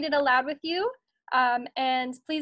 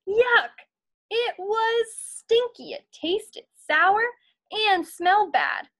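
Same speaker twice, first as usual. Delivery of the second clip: much higher, slow disgusted voice; 7 semitones higher, 3 dB louder, slower than usual. Acted. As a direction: pull back from the sour taste. Tasting the sour milk.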